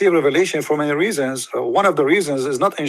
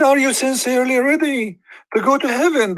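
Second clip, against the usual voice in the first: much higher, lilting teasing question; the Prophet asking; 9 semitones higher than usual. Retold or imitated. The Prophet teasing the follower, high and lilting. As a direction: dangle the question like a prize. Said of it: "He said".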